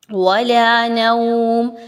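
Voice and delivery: slow, drawn-out tajweed recitation; Quran recitation. Shown as a demonstration. Teacher reciting the verse for students, slow melodic recitation. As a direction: recite each sound slowly and carefully. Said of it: "teacher reciting the verse".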